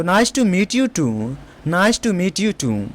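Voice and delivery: very slow, careful reply; slow model reply. Shown as a demonstration. Teacher slowly modelling a polite reply for students. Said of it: "आप कैसे जवाब देंगे".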